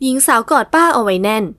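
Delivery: ordinary delivery, nothing marked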